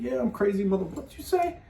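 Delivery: high, rising confrontational retort; defiant challenge. Retold or imitated. Private challenging a sergeant, high and defiant. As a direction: square up to the sergeant.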